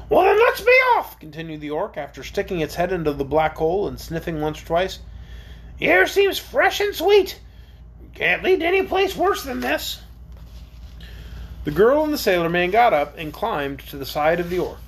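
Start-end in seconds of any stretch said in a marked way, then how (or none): 0.1-1.2 high, loud creature voice; eager orc. Acted. Orc urges them onward. 5.8-10.0 high-pitched orc character voice; confident orc. Acted.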